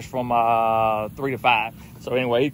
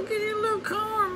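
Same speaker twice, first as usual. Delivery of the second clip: coaxing the hog; 4 dB quieter than usual. Real, not acted. Coaxing the hog toward the corn, high cooing. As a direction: coo at the hog to come eat.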